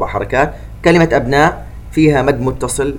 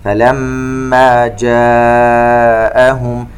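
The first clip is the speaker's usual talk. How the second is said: slow chanted tajweed recitation with echoing qāf; Quran recitation; 4 dB louder, much slower than usual. Shown as a demonstration. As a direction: recite slowly, bouncing the qāf. (تلاوة مرتلة ببطء مع قلقلة القاف)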